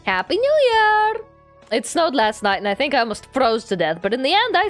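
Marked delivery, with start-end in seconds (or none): 0.0-1.1 high bright New Year shout; cheerful greeting. Acted. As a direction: call it out happily, much higher.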